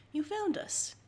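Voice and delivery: high playful greeting. Acted. Teasing viewers.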